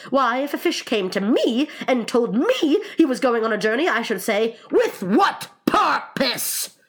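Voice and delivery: high, loud, building to a slow punch; emphatic Mock Turtle. Mock Turtle explains needing a porpoise, loud and high. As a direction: lean hard on the last words.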